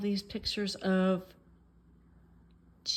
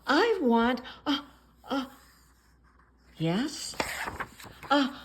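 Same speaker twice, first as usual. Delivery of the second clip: sneeze building; 6 semitones higher, 5 dB louder than usual. Acted.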